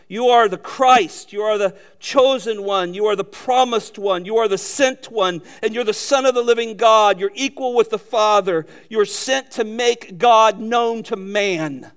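ringing declaration, each title punched; emphatic preaching. Acted. Preacher expanding Peter's confession of Christ, emphatically proclaiming. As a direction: proclaim each title with force, stretching the last phrase.

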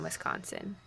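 normal talking voice

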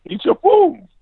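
high parental call; real order called across the room. Real, not acted. Parent calls to wandering child.

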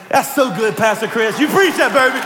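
high, loud hyped-up cheering; mock amen-shouting congregant. Preacher amening himself for a silent crowd, mock congregant cheer. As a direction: play your own over-excited congregation, pitch climbing. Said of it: "I just amen myself".

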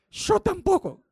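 esposa aguda. Retold or imitated. Esposa responde otra vez.

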